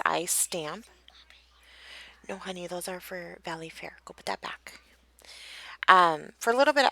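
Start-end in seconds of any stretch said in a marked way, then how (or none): 2.3-4.8 real under-the-breath instruction, away from the listeners; hushed aside. Real, not acted. Quiet aside to child mid-recording.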